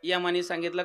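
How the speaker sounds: ordinary delivery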